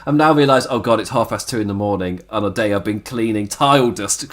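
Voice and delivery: ordinary talk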